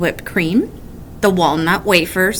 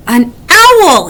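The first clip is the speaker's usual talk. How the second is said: louder startled cry; alarmed cry; 5 semitones higher, 6 dB louder than usual. A narrator's reading. Woodchuck spotting the owl's eye. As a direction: call out the danger. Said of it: "he cried".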